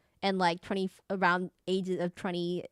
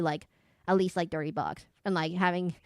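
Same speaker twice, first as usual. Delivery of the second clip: ordinary talk, nothing marked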